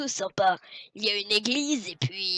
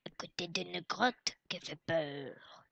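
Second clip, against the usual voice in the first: spooky whisper; 3 semitones lower, 9 dB quieter than usual. Acted.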